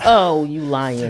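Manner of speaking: slow, laughing disbelief. Real, not acted. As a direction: laugh it out, teasing. Laughs at co-host's punchline.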